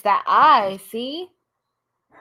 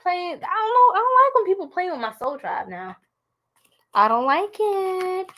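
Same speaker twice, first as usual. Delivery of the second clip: irritated; 9 semitones higher than usual. Pretend. Host defending her soul tribe, playfully irritated. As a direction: whine it high, mock-irritated. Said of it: "I'm getting irritated".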